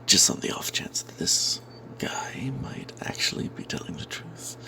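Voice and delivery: ordinary delivery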